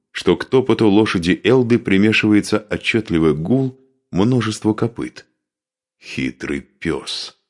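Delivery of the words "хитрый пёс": slow, low and soft, through gritted teeth. A narrator's reading. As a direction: spit it out slowly through clenched teeth. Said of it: "процедил Духарев".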